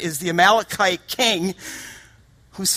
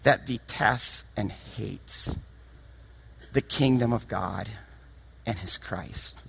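hushed; 9 semitones lower, 9 dB quieter, slower than usual. Real, not acted. Sudden drop after the shout.

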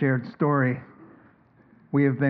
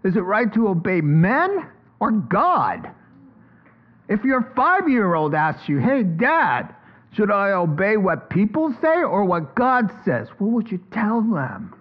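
slow, higher, emphatic challenge as Peter; bold Peter; 6 semitones higher than usual. Pretend. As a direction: challenge the council boldly. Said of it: "He said".

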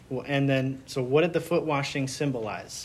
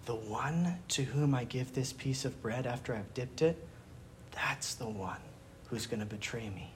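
hushed, low confiding quote, fading softer; near-whisper; 3 semitones lower, 8 dB quieter than usual. Retold or imitated. Jesus giving John the secret sign, retold in a hushed near-whisper. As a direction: lower it almost to a whisper, as if imitating a secret. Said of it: "whispering to John".

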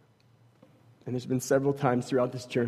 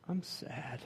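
imitated sad little boy, softer and higher; sad child; 5 semitones higher, 10 dB quieter than usual. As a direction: say it small, with a pout. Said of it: "Just with this sad frown".

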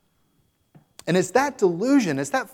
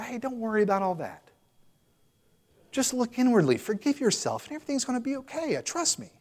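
higher and softer, coaxing sweetness; higher, softer woman's voice, falsely soothing; mock sweet chaplain; 7 semitones higher, 4 dB quieter than usual. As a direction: play the sugary chaplain brushing off his sins. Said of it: "trying to be sweet with them".

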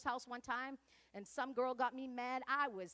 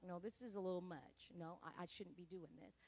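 lower, much softer uneasy voice; hushed self-doubt; 6 semitones lower, 10 dB quieter than usual. Retold or imitated. Viewer doubting what they are watching, hushed. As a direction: talk yourself down quietly.

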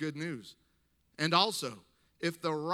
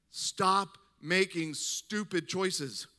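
slow, deliberate, each word weighed; stern and spelled out; blunt rebuke; 2 semitones higher, 4 dB louder, much slower than usual. Retold or imitated.

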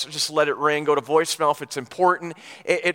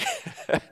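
breathy laugh; 8 dB quieter than usual. Real, not acted. Laugh after own apology.

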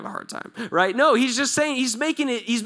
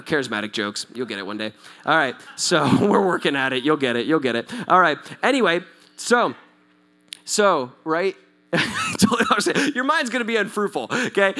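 normal talking voice

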